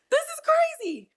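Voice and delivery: very high squeaky tearful voice; tearful disbelief. Real, not acted. Host shocked at her own crying, tearful disbelief. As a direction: squeeze it out high through tears.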